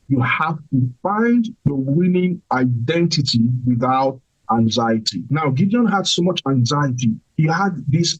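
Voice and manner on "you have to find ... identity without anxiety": slow it right down so the back hears; each word laid out slow and clear; slower, deliberate repeat. Shown as a demonstration. Preacher repeating for the back row, slow and deliberate. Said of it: "in case you did not hear me at the back".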